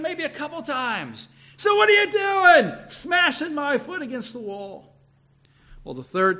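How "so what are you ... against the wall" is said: loud, high outburst at a stubborn animal; angry scolding. Acted. Balaam scolds his donkey for crushing his foot, angry shout. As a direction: yell in exasperation, much higher than usual.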